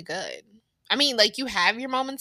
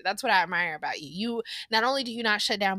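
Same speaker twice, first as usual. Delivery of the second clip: ordinary talk, nothing marked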